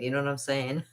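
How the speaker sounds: ordinary talk, nothing marked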